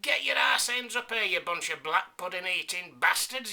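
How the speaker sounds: high, loud cocky insult yelled uphill; loud, high cocky Yorkshireman yell. Acted. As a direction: bellow it down the hill, cocky and sure. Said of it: "yelled the Yorkshireman, cocky and sure".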